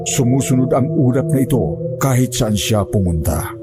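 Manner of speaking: usual speaking voice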